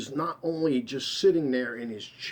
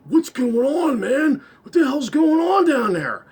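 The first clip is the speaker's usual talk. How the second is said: much higher and louder, urgent; alarmed friend; 13 semitones higher, 10 dB louder than usual. Retold or imitated. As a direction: call out high and loud like a startled buddy. Jim rushing upstairs alarmed.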